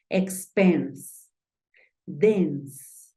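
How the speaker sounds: "expense" and "dense" with a short open e, as in Spanish; English short e. Shown as a demonstration. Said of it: "tú dices". (e corta como en español en "expense", "dense")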